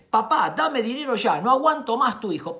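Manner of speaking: loud, high, urgent demand; demanding son's mail. Acted. Indignant father reads his son's mail to his wife, harsh demand. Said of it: "¿Por qué no me lo pidió así?".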